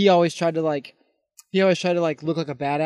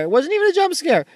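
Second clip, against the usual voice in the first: exasperated emphasis; 11 semitones higher than usual. Real, not acted. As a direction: push the repeat up high in disbelief. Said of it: "repeated with more force".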